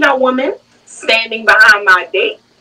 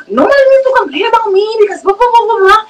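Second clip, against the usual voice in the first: mock defensive rant; 11 semitones higher, faster than usual. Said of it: "She was like".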